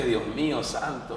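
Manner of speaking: soft, slow retold lament; sigh-like prayer of mild dismay, drawn out quietly; lamento abatido. Lamento al quedarse solo. (lamento suave y lento)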